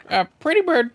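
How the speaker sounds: high loud parrot call. Acted. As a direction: squawk it out like a parrot.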